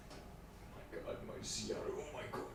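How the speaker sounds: breathless whispered awe; awed whisper. Real, not acted. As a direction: whisper it in amazement. Awed over the Xeon chip.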